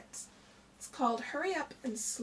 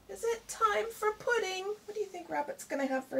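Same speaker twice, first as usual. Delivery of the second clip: eager little voice; 8 semitones higher than usual. Acted. Reader voicing an eager question about pudding, higher and excited. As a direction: ask like an excited child.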